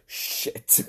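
muttered curse. Retold or imitated. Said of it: "her one reaction is just".

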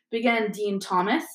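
ordinary delivery

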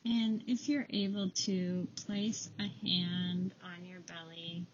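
calm them down with a quiet voice; guided meditation. Acted. Leads a breathing meditation.

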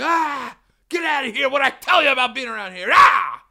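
high, very loud scare-off shout; acted angry yell. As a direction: roar like a shopkeeper chasing off trouble. Acting out store man scaring him off.

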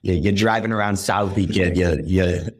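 Boston accent with dropped R's; sample a Southie-style Boston accent, R's left out. Shown as a demonstration. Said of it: "that's my Boston accent".

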